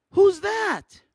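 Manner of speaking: high, loud, puzzled "Who's that?"; high loud friend's question; curious friend. Retold or imitated. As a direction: play the baffled schoolfriend pointing at a photo. Chelsea asking about photos.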